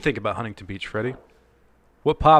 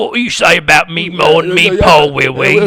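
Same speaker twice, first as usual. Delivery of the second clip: loud, higher mock Cockney; hammy Cockney rant; Cockney accent; 5 semitones higher, 10 dB louder than usual.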